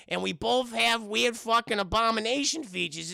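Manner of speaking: high, drawn-out cartoon old man; Whitey. Whitey thanking Jennifer for donuts, in his character voice. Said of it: "good old, uh, you know, Whitey".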